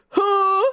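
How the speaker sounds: loud, high held mock gasp; hammed-up drawn-out "huh"; gasp for breath. A narrator's reading. Link falls out and gasps for breath, hammed-up gasp. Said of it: "gasped for breath".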